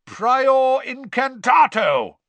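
slow, high, full roar; roared spell. A narrator's reading. Diggory casting a spell on the wand, roared. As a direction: roar out the incantation, drawn out. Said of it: "roared Mister Diggory".

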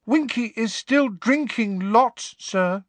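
high, slow sad elf voice; sad squeaky elf. Acted. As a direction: squeak it sadly, slowing down. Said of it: "he said sadly".

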